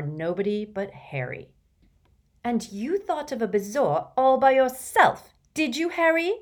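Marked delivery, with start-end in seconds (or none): 2.4-6.3 higher, tight, suppressed anger; through gritted teeth. A narrator's reading. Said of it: "she asked through gritted teeth".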